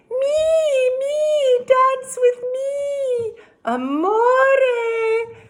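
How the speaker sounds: shrill high lovesick ladies, drawn out slowly; cried out. A narrator's reading. Village ladies chasing Anthony, high crying call. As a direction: call after him like swooning village ladies. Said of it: "cried the ladies close behind".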